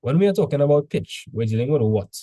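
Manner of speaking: ordinary talk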